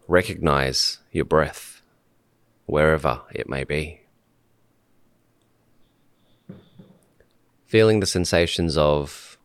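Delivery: calm meditation leader, long pauses between short phrases; unhurried guidance, letting each phrase settle in silence; guided meditation. Real, not acted. Host guiding podcast guests to sit and breathe, calm meditation voice.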